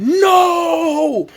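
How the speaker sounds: long, high mock scream; retold scream. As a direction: wail it out as a re-enacted cry. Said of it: "I'm screaming".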